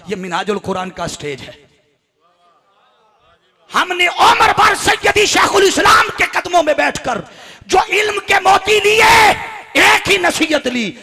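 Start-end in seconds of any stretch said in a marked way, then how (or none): 3.7-10.9 high, loud, impassioned sermon delivery; full-force preaching. Acted. Preacher honouring his teacher before the congregation, shouting. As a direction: proclaim it to a packed hall at a shout.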